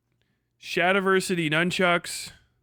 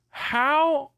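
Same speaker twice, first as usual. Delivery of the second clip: high disbelief; 9 semitones higher than usual. Real, not acted.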